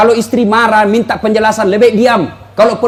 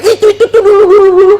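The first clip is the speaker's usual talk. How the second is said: mock whiny wife; 8 semitones higher, 3 dB louder, faster than usual. Mimicking wife dredging up past.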